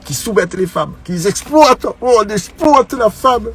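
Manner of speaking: mocking wail of the exploited woman; mock outraged voice. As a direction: ham up the indignant cry of those he mocks. Mocking the feminist view of patriarchy.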